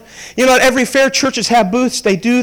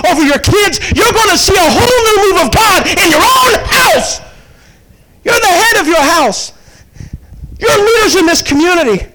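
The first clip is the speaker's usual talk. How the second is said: preaching at full force; 7 semitones higher than usual. Real, not acted. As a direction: build it up and cry out to the men.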